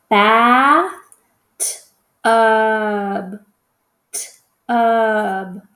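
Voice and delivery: stretched "Bath", then "tub" with t split from a long ub; sounded-out word. Shown as a demonstration. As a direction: draw out the vowels in "Bath, tub, tub". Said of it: "What sound do we hear?".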